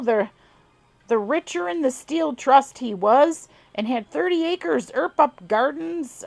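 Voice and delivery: put-on amateur southern accent on an old farmer's tale; southern twang. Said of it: "forgive my god-awful accent".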